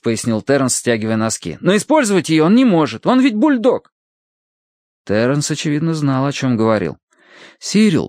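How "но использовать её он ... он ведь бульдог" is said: high, distressed protest; agitated explanation. A narrator's reading. Terence explains why his bulldog is drowning, agitated. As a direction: explain with rising urgency.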